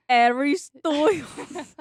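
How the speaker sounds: loud, slow "Stoyles" with a huge OY; exaggerated mispronunciation. Friend mocking the DJ's error, "Stoyles" boomed. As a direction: boom out "Stoyles", stretching the vowel. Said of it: "instead of [name]".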